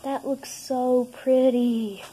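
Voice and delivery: slow, loud and marvelling; delighted admiration. Real, not acted. Kid admiring the fizzing cargo, slow loud delight. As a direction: stretch it out in real delight.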